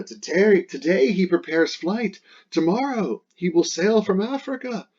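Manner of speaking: Dido's alarm. Acted.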